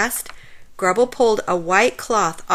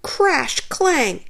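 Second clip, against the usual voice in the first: plain reading voice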